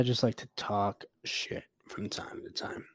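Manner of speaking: soft unvoiced whisper, almost to himself; whispered aside. Real, not acted. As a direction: breathe it out as a private confession. Repeats the thought to himself.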